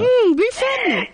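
man's high falsetto auntie; high, singsong gushing auntie; female auntie voice. Acted. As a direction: squeal it like a flattered auntie. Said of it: "from a male voice you change into a female voice".

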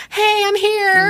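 very high, drawn-out sing-song greeting, slow; imitating Miranda. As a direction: chirp it like a friend showing up right on time. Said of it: "Miranda's like".

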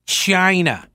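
China said as "Chyna" with a breathy sh-like start, slowed; Trump-style China. Shown as a demonstration. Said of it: "That's the way I say it".

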